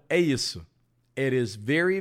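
normal talking voice

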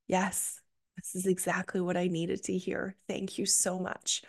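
whispered heartfelt gratitude; hushed thanks. Retold or imitated. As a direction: breathe out the thanks, moved. Tearful gratitude to the healer.

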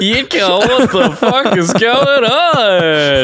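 put-on high, slow, drawn-out drunken wail; mock drunk outburst. Co-host redoing the drunk phone call, hammed-up drunken wail. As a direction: ham up a drunk's dismay, laughing through it. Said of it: "You said that wrong".

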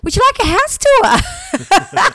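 high, slow, giggling question; wife laughs retelling her offer of a house tour; laughing offer. Retold or imitated. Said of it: "And then I go".

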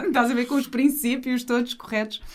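usual speaking voice

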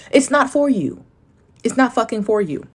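high, loud and insistent; emphatic. Real, not acted. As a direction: drive the point home. Emphatic retort to doubters.

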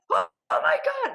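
high-pitched mock panic; panicked. Dancer realizing she missed her cue, high panicked squeal.

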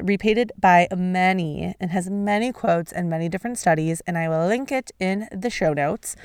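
normal talking voice

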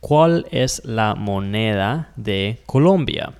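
¿Cuál es la moneda de Colombia? said slowly and clearly; careful teacher demo; slow Spanish demo. Shown as a demonstration. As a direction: model the question slowly. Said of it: "it would be".

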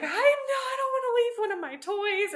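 put-on high, loud childish protest; whiny child. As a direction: whine like a four-year-old refusing. Daughter refuses, voiced high.

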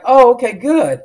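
retold brave front over a sunken heart; hiding disappointment. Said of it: "I didn't show him".

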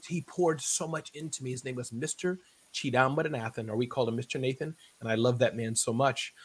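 ordinary talk, nothing marked

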